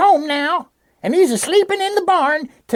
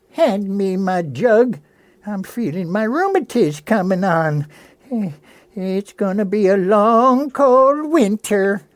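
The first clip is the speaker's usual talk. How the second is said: put-on old Granny voice; 9 semitones lower than usual. Granny voiced low, sketch.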